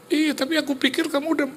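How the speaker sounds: very high mock-weepy voice; imitated tearful wife. Wife defends herself to her husband, mock tearful. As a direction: start to cry as you protest.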